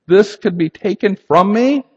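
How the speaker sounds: Jesus begging the Father, rising to a near cry; crying out in prayer. Acted. Preacher voicing Jesus in the garden, pleading and rising. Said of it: "cries out to God the Father".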